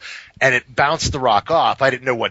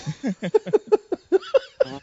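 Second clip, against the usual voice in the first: incredulous laugh; 12 semitones higher than usual. Retold or imitated. Laughs at the lame insult.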